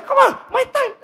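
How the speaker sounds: very high-pitched exasperated plea; hammed-up frustrated outburst; worked-up impatience. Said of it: "saya tambah emosi".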